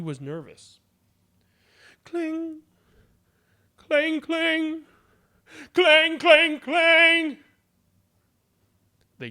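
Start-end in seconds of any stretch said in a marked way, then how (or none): 2.0-7.4 high, louder and louder clangs; clanging like a frantic bell; ringing bell. A narrator's reading. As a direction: ring the bell harder each time.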